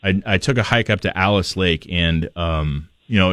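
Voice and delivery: usual speaking voice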